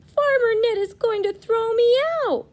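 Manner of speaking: high, loud panicky wail; worried scarecrow. Acted. Scarecrow panicking to the leaves, high and loud. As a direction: fret about being thrown out.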